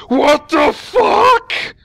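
high, loud, drawn-out disbelief; mock shocked. Commentator imitating a player shocked by Bat Riders, hammed-up shock. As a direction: shriek it slowly, utterly baffled. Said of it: "He always gets so shocked".

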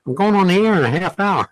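put-on high, fast, eager little boy voice; a kid proudly announcing his broadcast; lightly voiced young boy, fast and high; excited kid announcing. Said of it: "go down to the neighbor's house and say".

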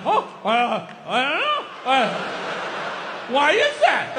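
halting, baffled stammer with a laugh; mock dumbfounded men. TV sports hosts pretending not to know the answer, mock dumbfounded. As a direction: play the clueless pundit, stalling. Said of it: "like, dumbfounded".

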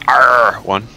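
R ONE with the R slurred into a long growled "arrr"; mumbled. Shown as a demonstration. Player re-enacting his misheard callout, mumbled. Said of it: "I'm mumbling".

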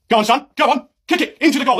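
pushy football dad shouting at his son; touchline shout. Acted. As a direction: urge the kid on from the touchline.

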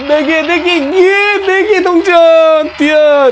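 high, drawn-out cheer for the coins; excited cheering. Real, not acted. Gamer cheering his runner through four coins, high drawn-out cheer. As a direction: stretch it out in triumph.

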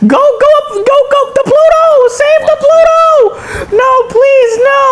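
high, wailing cartoon panic; panicked pleading. Mouse begging for Pluto to be saved, panicked. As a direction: plead frantically in a high cry. Frantic pleas to save Pluto.

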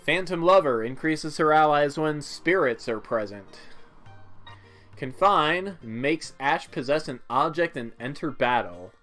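ordinary delivery

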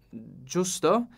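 ordinary delivery, nothing marked

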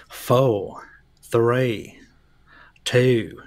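drawn-out southern drawl countdown; southern accent. Pretend. Co-host counting down the deletion, mock southern accent. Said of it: "It's my southern accent".

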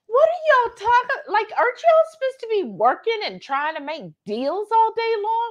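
high-pitched, mock-incredulous questioning; exasperated disbelief. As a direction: demand an explanation in mock disbelief. Acts out her baffled reaction.

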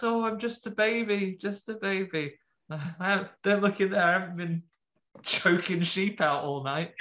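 high, mock-innocent baby voice; innocent baby act. Imp faking innocence in the car, high baby voice. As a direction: play the sweet innocent baby.

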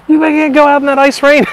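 high, loud mock yell; retold yelling wife. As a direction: imitate a scolding wife. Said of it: "My wife is yelling at me".

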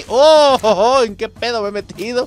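high, loud startled outburst; sudden alarm at the trouble; alarmed yelp. Real, not acted. Gamer suddenly swarmed by guards, loud alarmed cry.

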